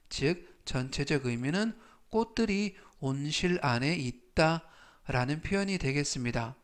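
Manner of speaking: ordinary delivery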